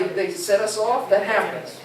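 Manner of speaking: usual speaking voice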